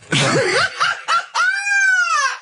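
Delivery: high, shrieking laugh; howling with laughter. Real, not acted. Laughs at Toad Day joke.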